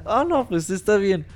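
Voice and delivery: high, chirpy squirrel voice; Conker voice. Retold or imitated. Squirrel politely asks the count why he eats nothing, high cartoon voice.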